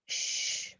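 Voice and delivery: ordinary delivery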